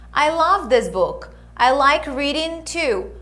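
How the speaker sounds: clear, measured English lesson delivery; model dialogue reading. Acted. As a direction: read each line for them to repeat. Reads dialogue lines for students. (leitura de diálogo modelo)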